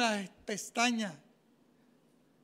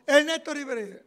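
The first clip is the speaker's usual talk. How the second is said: high, loud call of the name; 5 semitones higher, 8 dB louder than usual. Retold or imitated. The examiner calling the top student's name, loud. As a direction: announce the winner. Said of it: "Cuando me dijo".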